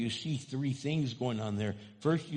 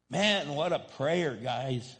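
ordinary delivery

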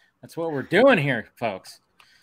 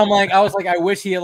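ordinary delivery

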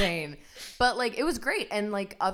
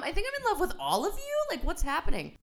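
giddy adoration; 12 semitones higher than usual. Retold or imitated. Acting overwhelmed by the praise.

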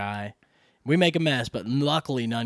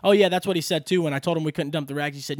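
ordinary talk, nothing marked

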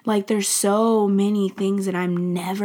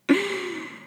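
high teary laugh; a quick laugh through tears; real emotional giggle; 11 semitones higher than usual. Real, not acted. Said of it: "getting teary".

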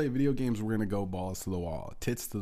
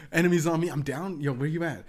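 loud, higher gamer callout; urgent calling out; 7 semitones higher, 6 dB louder than usual. Retold or imitated. Gamer calling his buddy for help, urgent. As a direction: call urgently for backup, louder and higher. Retold calling out during game.

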